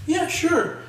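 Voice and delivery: high-pitched mock eager reply; eager agreement. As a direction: squeak out a quick imitated yes. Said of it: "He's like".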